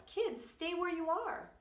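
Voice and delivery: loud, high, slow urgent yell; father quail yelling from the flood. A narrator's reading. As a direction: shout a warning to the kids. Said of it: "He yells".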